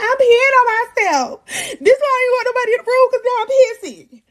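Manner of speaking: very high, tearful whine; mock panicked wail. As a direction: wail it in hammed-up distress. Retelling her panic as waters broke.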